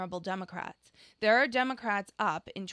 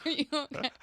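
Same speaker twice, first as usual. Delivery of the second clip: laughing question; 5 semitones higher than usual. Real, not acted. Laughing at the clip.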